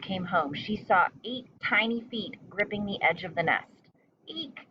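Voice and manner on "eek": high startled squeal; shrill cry. A narrator's reading. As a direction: cry out in alarm. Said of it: "she cried".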